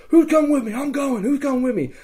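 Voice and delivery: high, projected call for volunteers; retold rallying call. Player recruits rescuers, high rallying call. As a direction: throw it out to the crowd.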